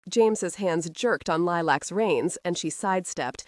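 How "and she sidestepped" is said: ordinary delivery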